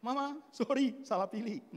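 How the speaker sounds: high, loud comic confession; hammed-up waking husband; husband's mock apology. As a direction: blurt it out to the wife. Acting husband waking his wife.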